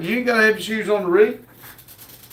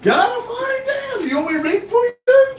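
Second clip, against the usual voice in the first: exasperated shout. Real, not acted. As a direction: blow up half laughing at being asked. Exasperated laughing outburst.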